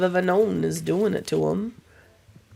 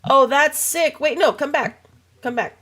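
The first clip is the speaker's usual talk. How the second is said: much higher and louder, thrilled then calling back; excited outburst; 9 semitones higher, 4 dB louder than usual. Real, not acted. Streamer thrilled by the game, calling after a character. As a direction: gasp of delight, then plead with it to come back.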